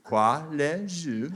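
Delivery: very slow fake French, word by word. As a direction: spell out nonsense French slowly for the listener. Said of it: "I'll slow it down for you".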